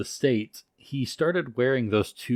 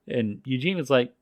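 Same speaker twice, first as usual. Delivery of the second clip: normal talking voice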